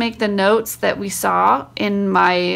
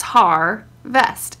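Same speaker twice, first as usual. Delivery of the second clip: har, a clear pause, vest; spaced syllables. Shown as a demonstration. Explaining the printed word's divided syllables, spaced apart. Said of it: "There's a space between the syllables".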